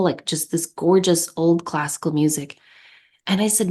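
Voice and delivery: ordinary talk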